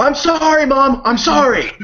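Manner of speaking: high pleading apology voice; mock whiny kid. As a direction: whine it like a scolded kid. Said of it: "she had to yell at him".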